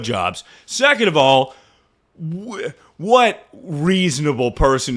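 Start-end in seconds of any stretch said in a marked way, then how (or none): none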